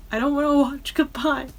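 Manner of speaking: half-mock upset pleading; whiny upset. Real, not acted. Upset fan refusing to watch the Spice Girls video, high whiny plea. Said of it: "I can get emotional about it".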